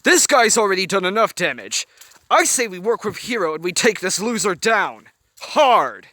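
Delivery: rally them to fight; angry rallying. A narrator's reading. Anger rallying.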